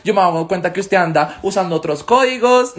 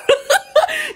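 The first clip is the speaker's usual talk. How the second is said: carcajada. Real, not acted. Laughs at own joke.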